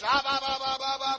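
rapid high monotone "bah bah"; imitated congregation babble. Preacher imitating din inside the church, mock babble. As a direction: mimic a roomful of noisy praise.

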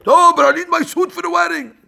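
high, loud whining complaint; hammed-up spoiled husband demanding his wedding suit; whiny husband.

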